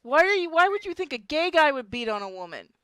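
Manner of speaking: ordinary delivery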